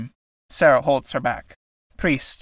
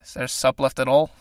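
anxious. A narrator's reading. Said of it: "Michael, anxiously".

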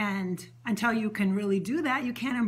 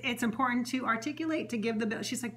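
normal talking voice

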